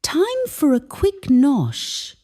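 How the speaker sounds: high, eager announcement. Acted. Peckish mum grabbing a sarnie, high eager voice. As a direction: perk up at the thought of food. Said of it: "and says".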